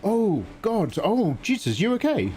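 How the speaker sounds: high, startled oh God; alarmed, checking the viewer is okay; shocked concern. Real, not acted. Streamer reacts in shock to viewer's crash.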